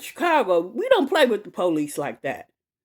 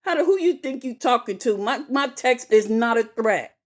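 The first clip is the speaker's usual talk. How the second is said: angry retort; 6 semitones higher than usual. Retold or imitated. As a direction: snap back at the officer. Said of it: "I was like".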